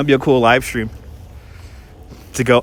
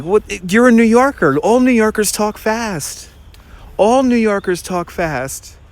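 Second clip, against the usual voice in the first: higher-pitched retold tourist protest; imitated tourists; 6 semitones higher than usual. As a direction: lightly voice tourists, drawing it out. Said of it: "and they were like".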